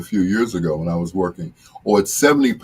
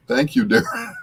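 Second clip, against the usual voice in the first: high, laughing through the words; laughing thanks; 14 semitones higher than usual. Real, not acted. Friend Daryl teases him, thanking him while laughing.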